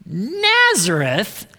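slow, drawn-out, questioning disbelief; incredulous exclamation. A narrator's reading. Nathanael reacting to Philip's news, incredulous exclamation. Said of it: "exclaimed Nathanael".

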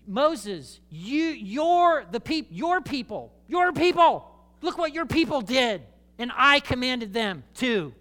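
high, loud, slow indignant accusation; playfully furious God pointing at Moses; God's fury. Acted. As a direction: blame Moses for his people with rising outrage. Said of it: "You can kind of feel the fury".

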